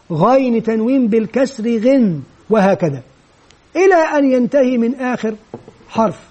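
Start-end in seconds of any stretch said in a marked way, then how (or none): none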